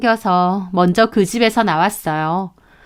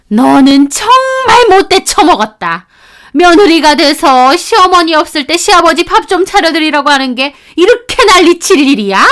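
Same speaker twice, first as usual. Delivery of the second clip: berating mother-in-law; 7 semitones higher, 5 dB louder than usual. Acted. Mother-in-law berating daughter-in-law by phone. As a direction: scold her bitterly.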